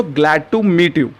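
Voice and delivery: very slow, each word spaced out for learners; slow teaching model. Shown as a demonstration. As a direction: say it slowly and clearly like a teacher modeling a phrase. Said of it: "English में आप क्या बोल सकते हैं".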